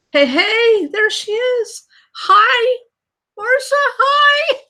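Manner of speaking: high, loud squealing hello; light up and call out to a friend arriving; excited greeting. Real, not acted. Vlogger squealing hello to Marcia joining the stream. Greeting a viewer who joined.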